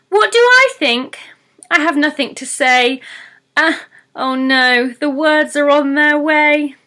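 high, loud panicked pixie voice; the truth bursting out, alarmed; panicky pixie. Acted.

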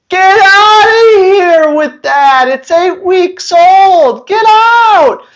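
high, loud, outraged get out; high-pitched, loud, mock-indignant dismissal; mock outraged yell. Real, not acted. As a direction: shoo the idea away with a loud outraged cry. Dismissing early obedience training.

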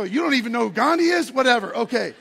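a student snapping back at his barber. Retold or imitated. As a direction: push back, exasperated and loud. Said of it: "I go".

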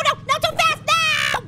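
high, loud protest at the game; loud alarmed yell; panicked shout. Real, not acted. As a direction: cry out as it slips away. Game going wrong.

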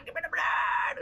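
eager crowd. Retold or imitated. Crowd voiced begging for blood.

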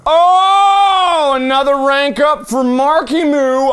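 high, drawn-out celebration; gloating high cheer; triumphant crowing. Pretend. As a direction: announce your own victory in a high whoop. Crows about ranking up.

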